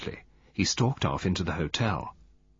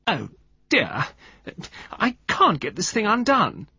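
high, petulant whine; loud, petulant; 11 semitones higher, 3 dB louder than usual. A narrator's reading. Woman struggling with a screw top, loudly petulant. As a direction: complain for others to overhear. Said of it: "She spoke loudly and petulantly".

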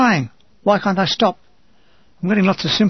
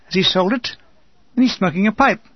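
usual speaking voice

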